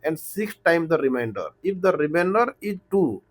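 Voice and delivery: very slow, word by word reading of the question. Real, not acted. Teacher reading the word problem aloud to students, very slowly. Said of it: "इतना धीरे-धीरे बोल रहा हूँ".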